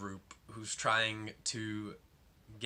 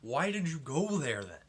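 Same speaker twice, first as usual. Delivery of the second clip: higher, louder, fed-up rant; exasperated outburst; 6 semitones higher, 5 dB louder than usual. Retold or imitated. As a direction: vent at the whiny kid, half yelling. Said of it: "and it's like".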